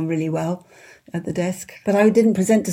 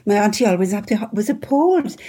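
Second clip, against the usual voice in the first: ordinary talk, nothing marked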